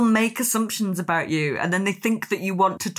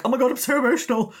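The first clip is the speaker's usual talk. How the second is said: mock gushing; 5 semitones higher, faster than usual. Mocking an overemotional writer, fast gushing voice. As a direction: ham up a gushy emotional sigh. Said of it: "she can't stop doing this".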